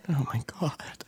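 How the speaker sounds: breathy shocked whisper. Real, not acted. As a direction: whisper it in disbelief. Hair in the drink again.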